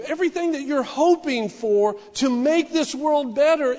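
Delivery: loud, high, full-force sermon delivery; fiery preaching. Acted. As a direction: preach it to the congregation with fire.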